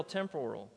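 temporal with the stress on POR, tem-POR-al; stress on second syllable. Shown as a demonstration. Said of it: "Put the accent on the right syllable".

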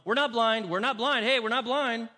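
loud high insistence, we're not blind; mocking the blind men's protest. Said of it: "walk around going".

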